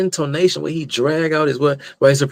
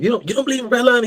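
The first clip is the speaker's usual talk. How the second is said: mock incredulous debater; 10 semitones higher, much faster than usual. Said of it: "she said".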